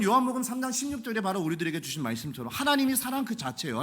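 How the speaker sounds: ordinary talk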